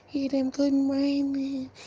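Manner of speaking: high, soft, slow sing-song greeting; mock two-faced good morning; fake friendly greeting. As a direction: put on a fake sweet hello. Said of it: "some people come".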